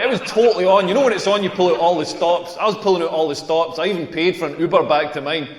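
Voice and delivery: normal talking voice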